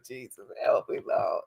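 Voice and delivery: breathy whispered prayer; hushed little plea. Real, not acted. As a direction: whisper it under your breath. Whispered plea after laughing.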